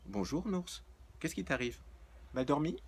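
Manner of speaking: high, sugary innocent voice; faux-sweet greeting to an angry Nours; sweetly mocking. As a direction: play innocent, all honey.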